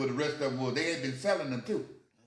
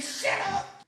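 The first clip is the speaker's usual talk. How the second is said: high-pitched mock outburst; irritated cop. Policeman silencing the hollering teen, irritated. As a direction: cut off the noise in a high yell. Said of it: "He said".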